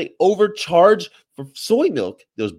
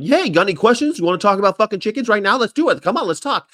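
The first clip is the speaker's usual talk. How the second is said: high, loud, rushed pitch; eager outreach; 7 semitones higher, 5 dB louder, faster than usual. Retold or imitated. Activist eagerly approaching passersby, high and excited. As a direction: bounce up to strangers eager to talk. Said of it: "I wanna be like".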